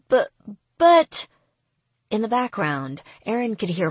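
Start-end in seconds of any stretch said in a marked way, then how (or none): 0.1-1.3 flustered protest. Acted. Rihanna protests, drunk.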